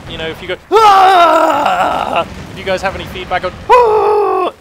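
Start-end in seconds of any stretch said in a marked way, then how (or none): 0.7-2.3 long, very loud high scream; demonstration scream. Shown as a demonstration. Caster demonstrates screaming to viewers, mock scream. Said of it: "whether you like the screaming". 3.7-4.5 short, piercingly high loud scream; demonstration scream. Shown as a demonstration. Caster interrupts his feedback request, mock scream. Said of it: "whether you like the screaming".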